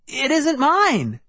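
high, slow insistent protest; cried out again. A narrator's reading. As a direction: repeat it pleading and high. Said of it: "I repeated".

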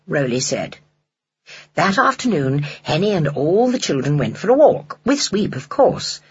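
ordinary delivery

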